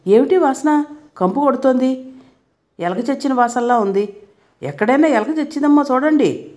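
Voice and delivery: a wife yells at her mother-in-law about the smell; yelling angrily. Acted. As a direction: snap at the mother-in-law in disgust. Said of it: "అత్తగారి మీద రంకెలేసింది".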